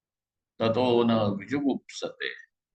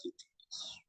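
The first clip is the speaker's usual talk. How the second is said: breathy whispered ending; 20 dB quieter than usual. Real, not acted. Teacher whispering the conclusion to his students. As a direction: drop to a whisper to seal the point. Hushed close of a key point.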